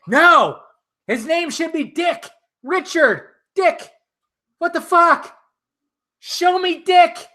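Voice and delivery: high, exasperated rant; mock indignation. Vlogger reenacting his theater rant, high and indignant. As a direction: complain in a high, put-out voice. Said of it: "I was like".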